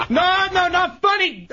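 high, agitated outburst; upset yell. Real, not acted. Tire victim protests to laughing hosts, upset yell. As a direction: burst out in protest at being laughed at. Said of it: "I really need to yell right now".

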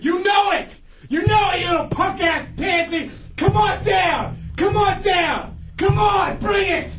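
high, dragged-out furious shouting; furious yelling. Real, not acted. Chunks screaming challenges at the caller. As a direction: scream the taunts at him. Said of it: "it sounds like you're yelling at yourself".

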